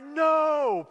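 high, stretched protest; mock dismay. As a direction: act out a pained refusal. Dismay at one more rep.

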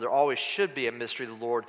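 normal talking voice

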